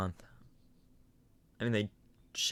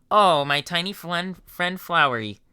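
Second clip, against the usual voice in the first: higher, louder slow fond skeleton; affectionate mispronounced name; sweetly oblivious Papyrus; 6 semitones higher, 6 dB louder than usual. Acted. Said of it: "Papyrus voice".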